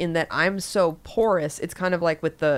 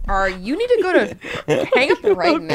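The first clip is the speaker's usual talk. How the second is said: laughing mock hostess; 2 semitones higher, 6 dB louder than usual. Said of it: "the hostess is like".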